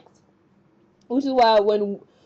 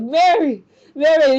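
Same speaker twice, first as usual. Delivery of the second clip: high, loud retold cry after someone; 5 semitones higher, 6 dB louder than usual. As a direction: call out the name as Francis, high and loud. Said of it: "we see Francis crying out like".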